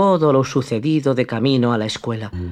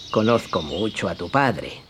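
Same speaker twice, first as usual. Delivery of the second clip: low, soft, sly fox voice; a hushed, crafty greeting; 6 semitones lower, 6 dB quieter than usual. Acted. The fox greets Pinocchio, low, soft and sly.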